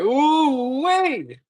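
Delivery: high-pitched, thrilled ooh then wait; excited surprise. Retold or imitated. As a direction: squeal at the news of a big fight. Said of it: "I was like".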